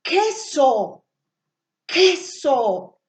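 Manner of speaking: "queso" with the opening k sound set off firmly; sound demonstration. Shown as a demonstration. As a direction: say "queso" in two clear syllables, hard k first. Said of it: "escuchará el sonido".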